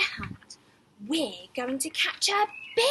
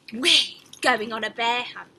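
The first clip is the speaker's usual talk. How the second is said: usual speaking voice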